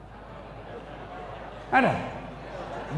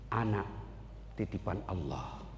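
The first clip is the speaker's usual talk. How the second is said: soft calm reply; 13 dB quieter than usual. Acted.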